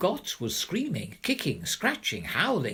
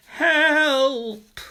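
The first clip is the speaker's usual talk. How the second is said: resounding cry; 12 semitones higher, 8 dB louder than usual. A narrator's reading.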